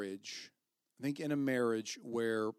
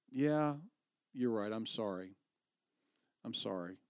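humble apology; 3 semitones lower, 7 dB quieter than usual. Shown as a demonstration.

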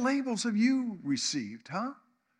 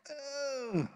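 a soft, higher, mock-disgusted ugh of a girl pulling away; 6 semitones higher, 6 dB quieter than usual. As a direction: imitate a scrunched-up face of disgust in one grunt. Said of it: "she scrunched up her face like".

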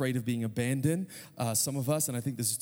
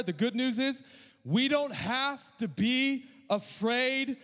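higher-pitched, emphatic sermon delivery; ringing gospel proclamation; impassioned preaching; 8 semitones higher than usual. Real, not acted. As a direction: proclaim good news to a congregation with full conviction. Preaches at full force.

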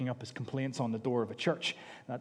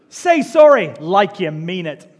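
louder, scolding parent command; stern parent; 3 semitones higher, 15 dB louder than usual. Retold or imitated. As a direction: demand it sternly. Parent scolding child again.

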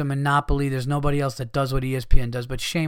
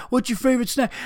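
higher, mocking reporter voice; 7 semitones higher, faster than usual. Mocking media day reporters, he apes a silly question.

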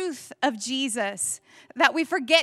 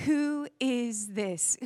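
slow wonder; 3 dB quieter, much slower than usual. Shown as a demonstration.

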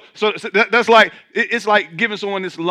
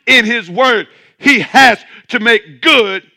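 preaching=1; 4 semitones higher, 9 dB louder than usual. Real, not acted. Preaching at full force.

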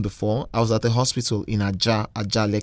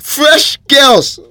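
anguished cry; 12 dB louder than usual. Real, not acted. Lamenting the drowned young women.

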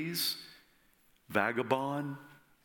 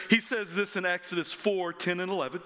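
usual speaking voice

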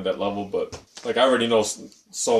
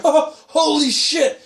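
high, loud, drawn-out mock astonishment; retold amazed yell; 7 dB louder, much slower than usual. Host retells spotting the restaurant sign, high drawn-out yell. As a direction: stretch it out, reliving the delighted shock.

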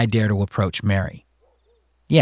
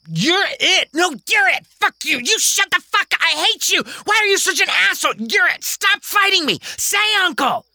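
put-on high, angry kid cursing; high child's fury. Kingston cursing at Mary, high and angry. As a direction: lash out at her. Kids wrestle shouting.

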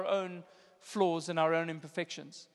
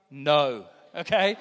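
low, loud, final no; deadpan parental refusal, dropped deep; blunt refusal; 6 semitones lower, 5 dB louder, slower than usual. Retold or imitated. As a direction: shut the request down abruptly. Punchline refusal to the crying child.

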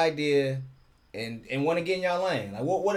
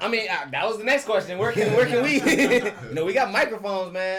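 talking through laughter; higher and laughing; 6 semitones higher, 5 dB louder than usual. Real, not acted. Laughing at own request.

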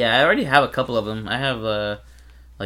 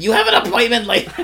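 higher, louder squawky AI voice; Gilbert Gottfried; 10 semitones higher, 6 dB louder, faster than usual. Retold or imitated. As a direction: squawk it like Iago the parrot. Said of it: "could you imagine".

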